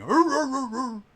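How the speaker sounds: high, loud imitation dog bark; Lassie barking. Game master imitates the picture of Lassie going, high barking. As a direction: yap like an excited dog. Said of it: "of Lassie going".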